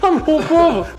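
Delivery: high laughing pity. Real, not acted. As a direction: laugh through mock pity. Laughing at the chili victim.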